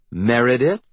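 put-on rising, cautionary use of a child's name; warning. A narrator's reading. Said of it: "Mom warns".

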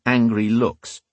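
usual speaking voice

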